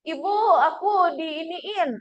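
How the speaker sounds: a lightly voiced child tattling to mother; whiny child. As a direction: whine to mum like a hurt child.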